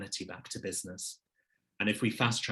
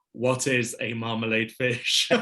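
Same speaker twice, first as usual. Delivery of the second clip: amused, slower quote, breaking into a laugh; 7 dB louder than usual. Retold or imitated. Guest laughs quoting what people google about his company, amused. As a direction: read the search query out through a laugh.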